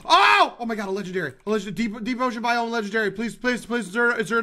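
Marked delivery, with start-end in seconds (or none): 0.1-0.6 high, loud "oh" of surprise; real startled shout; excited yell. Real, not acted. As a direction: cry out on spotting a treasure. Startled reaction spotting a legendary.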